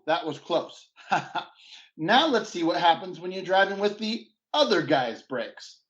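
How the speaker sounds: ordinary talk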